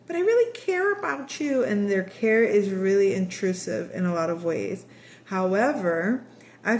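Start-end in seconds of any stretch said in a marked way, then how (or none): 0.1-1.6 much higher, sugary mock mother; prying mother. Intrusive mother figure pleading with her child, high mock voice. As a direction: imitate an overbearing mother pleading. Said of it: "This person".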